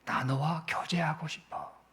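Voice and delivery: slow, unvoiced whisper as God's voice; God's longing, whispered slowly. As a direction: whisper the invitation tenderly.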